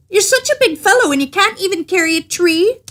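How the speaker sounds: scoffing tailor, pitched well up; mocking little tailor. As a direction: needle the big fellow, up high. Tailor mocks the giant.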